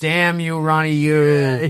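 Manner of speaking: loud, drawn-out curse at a rival; hammed-up aggrieved cry with a long last word; Kane Hodder. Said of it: "That's my Kane Hodder impression".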